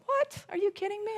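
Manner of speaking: talking herself up with playful disbelief; mock incredulous. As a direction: scoff at the very idea, pitch leaping up. Imagined confident self-talk.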